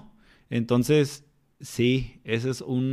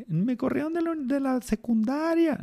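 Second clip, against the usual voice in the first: put-on high kid voice; 14 semitones higher than usual. The boy tells his mentor he was expelled, in a high kid voice. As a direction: sound like the boy confessing.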